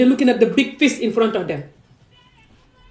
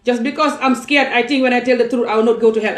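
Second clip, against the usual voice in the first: usual speaking voice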